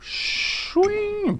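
high, playful metallic swish; slicer sound effect. Pretend. Dad playing with a cheese slicer, high swish sound effect. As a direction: voice the blade slicing through.

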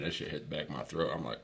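ordinary talk, nothing marked

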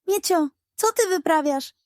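outraged pug. A narrator's reading. Pug scolding the cat, lightly voiced and shocked. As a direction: gasp it out in disbelief.

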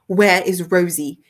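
loud, slow, weighted question; demanding. A narrator's reading. Gentleman barging in after Rosie, loud slow demand. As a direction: demand an answer, spacing the words. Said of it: "he demanded".

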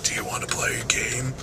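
whispered creepy villain impression; Jigsaw. Vlogger imitating a film villain's catchphrase, whispered impression. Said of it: "sounds like Jigsaw".

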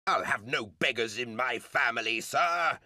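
roaring. Acted. Furious father roaring at his son George. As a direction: bellow at the disobedient son. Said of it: "his father roared".

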